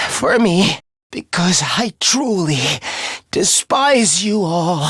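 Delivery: weak, halting last words with long breaks; dying. Acted. Defeated villain bidding Takumi goodbye, dying and halting. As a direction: push the words out haltingly as life drains away.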